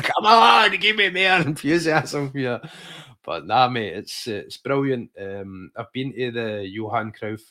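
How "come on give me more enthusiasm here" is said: high, loud mock plea; egging her on, much higher; hammed-up coaxing with a laugh; pleading for enthusiasm. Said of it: "And I'm like".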